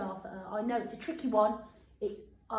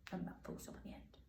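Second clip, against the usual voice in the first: whisper; 18 dB quieter, faster than usual. Shown as a demonstration. Teacher whispers aside to class.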